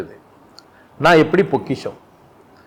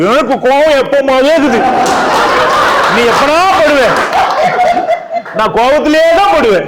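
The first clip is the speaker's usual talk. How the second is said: mock angry man; 10 semitones higher, 4 dB louder than usual. Acting out an angry person.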